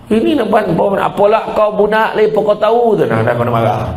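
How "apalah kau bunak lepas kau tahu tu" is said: ordinary talk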